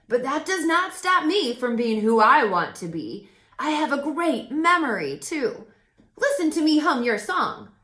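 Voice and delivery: loud, high cheerful crow; crow Woof voice. A narrator's reading. Crow introduces himself to Jazz, loud and cheerful. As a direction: play the chatty friendly crow, loud and bright.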